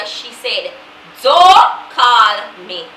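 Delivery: ordinary delivery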